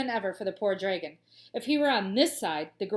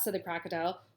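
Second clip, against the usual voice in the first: plain reading voice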